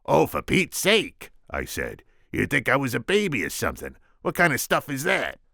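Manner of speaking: put-on high, indignant kid's protest. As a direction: huff like an offended boy. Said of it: "I really sounded put out".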